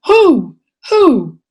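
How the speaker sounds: high, loud falling hoots; owl-like hoot. Acted. Child stalling at bedtime, hooting like an owl. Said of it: "your eyes swell wide as owls".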